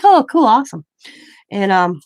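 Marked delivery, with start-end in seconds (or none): 0.0-0.9 mock excited. Her reply to Dime retold.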